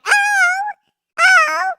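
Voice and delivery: high drawn-out mock "oh"; mock moaning. Imitating [name] to the chat, high mock moan. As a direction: imitate high, exaggerated moans. Mimicking a celebrity in sex.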